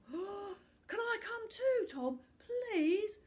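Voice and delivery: high, slow, near-tearful plea; small boy begging to ride with his hero; eager pleading boy. Acted. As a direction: beg like a kid left behind. Boy begs to come along.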